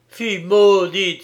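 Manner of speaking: put-on loud furious outburst at the girl; angry cry. A narrator's reading. Young man curses the girl, shouting in anger. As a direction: hurl it at her in fury. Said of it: "s'écria-t-il avec colère".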